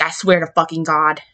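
usual speaking voice

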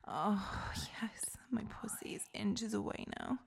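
ordinary talk

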